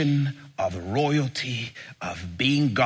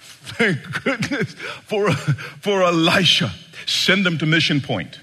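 relieved king; 6 semitones higher, 3 dB louder than usual. Retold or imitated. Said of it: "King Joram is like".

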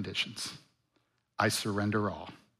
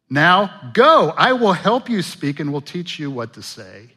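God commanding; 3 semitones higher, 6 dB louder than usual. Retold or imitated. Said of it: "I think that's how he said it".

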